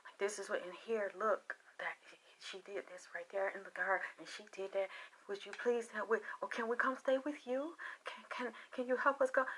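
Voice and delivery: whispered, pleading re-enactment; hushed begging. Retold or imitated. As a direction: plead in a hushed voice, as if afraid to be overheard. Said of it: "and we're begging".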